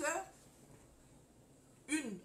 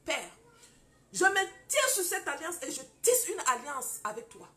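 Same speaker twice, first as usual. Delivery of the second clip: loud, fervent prayer over the ring, building to cries; 6 dB louder than usual. Retold or imitated. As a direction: pray it out with full conviction, like in church. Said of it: "j'ai dit".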